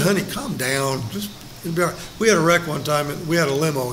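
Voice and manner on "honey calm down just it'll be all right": calm reassurance. Retold or imitated. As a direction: settle her down gently. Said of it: "I said".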